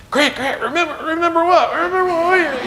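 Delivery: high, squeaky mock desperation; desperate questioning. Dad begging his son to explain, hammed-up desperate. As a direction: beg for the answer in a strained, high voice.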